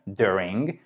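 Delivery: "during", accented on its opening syllable; stress on first syllable. Shown as a demonstration. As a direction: lean on the DUR of "during", let -ing drop away. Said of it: "va être plus haute et un peu plus longue".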